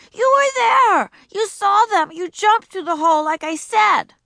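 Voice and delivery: put-on high, loud furious child; shouting. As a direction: accuse him at full voice. Ramona shouting.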